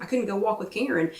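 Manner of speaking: ordinary talk, nothing marked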